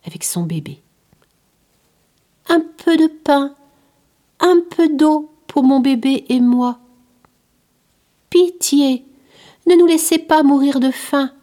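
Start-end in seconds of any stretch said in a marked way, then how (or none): 2.5-11.3 higher, louder plea for bread and water; a hungry mother begging a stranger for help; begging beggar woman. Acted. Beggar woman begs the passing boy to feed her baby, pleading. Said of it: "suppliait-elle".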